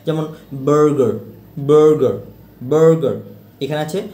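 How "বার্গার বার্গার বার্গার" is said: "বার্গার" with an r-coloured "ur" in the first syllable; loud, separated repeats of "বার্গার" as "bur-ger"; r-coloured vowel. Shown as a demonstration. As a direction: model "বার্গার" firmly with an English "er" vowel. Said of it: "রফলার টাইপের উচ্চারণ".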